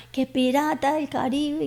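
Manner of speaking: high, drawn-out mock taunt; imitated children's yelling. Children jeering at her in a restaurant, high mimicked taunt. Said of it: "Me gritaba".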